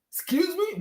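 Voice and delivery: high incredulous squeak; sky-high offended excuse me; outraged excuse me. Real, not acted. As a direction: pitch it way up in disbelief. Outraged fan.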